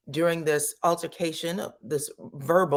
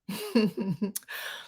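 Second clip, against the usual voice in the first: embarrassed laugh; 5 semitones higher, 5 dB quieter than usual. Real, not acted. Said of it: "laughing at own singing".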